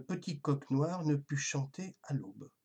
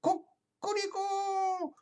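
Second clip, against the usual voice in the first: high, slow cock-a-doodle crow; rooster crow; much slower than usual. A narrator's reading. Rooster crowing at the castle tower, high and slow. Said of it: "lançait un puissant".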